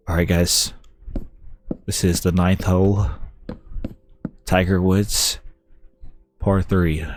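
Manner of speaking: mock calm golf commentator, lightly voiced. Gamer imitating golf commentators, a hint of soothing calm. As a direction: hush it down like a golf broadcast. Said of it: "They're always like".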